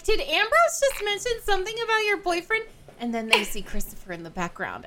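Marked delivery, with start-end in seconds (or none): 0.0-2.6 high, loud, eager question; acted prying parents. Heroine's parents on FaceTime ask about boyfriend, excited and high. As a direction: voice the parents pouncing, pitched way up. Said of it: "And they're like".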